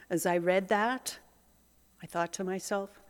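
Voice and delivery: usual speaking voice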